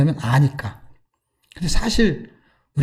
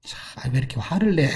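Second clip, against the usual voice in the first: soft, lower, imitating patient calming; gentle soothing; 4 semitones lower, 8 dB quieter than usual. God calms the angry prophet, gently soothing. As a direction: quietly coax down someone who is cross. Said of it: "다스려요".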